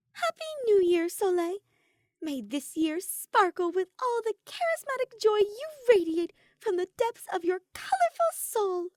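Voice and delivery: joyful greeting. A narrator's reading. Ophelia wishing Soleil happy new year, high and joyful. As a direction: burst out with delight.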